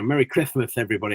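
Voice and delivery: imitating a lisp from metal fangs. Actor showing how metal fangs made him talk, lisping. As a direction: say it with a thick lisp, s sounds turning to th. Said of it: "I had this poor lisp going on".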